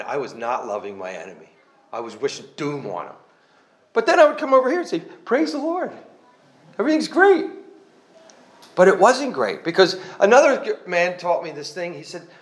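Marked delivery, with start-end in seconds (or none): 5.0-7.5 bright, much higher, putting on a happy front; mock fake cheer. A man faking joy at church in a bright, higher voice. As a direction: chirp it like all is fine when it isn't.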